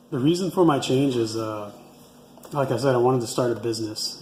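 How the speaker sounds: usual speaking voice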